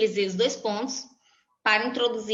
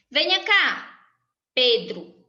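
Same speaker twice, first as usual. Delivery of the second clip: louder call to come over; 4 dB louder, slower than usual. Shown as a demonstration. Teacher demonstrating calling Pedro over, raised voice. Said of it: "Eu invoquei o Pedro, eu chamei".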